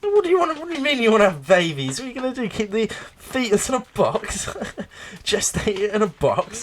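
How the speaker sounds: hammed-up incredulous outburst; exasperated rant. Retelling the sketch to a friend, high and incredulous.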